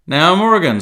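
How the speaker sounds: loud, high stern call. A narrator's reading. Silver grilling Morgan before Jim, very stern. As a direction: pin him down sternly. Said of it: "said Long John very sternly".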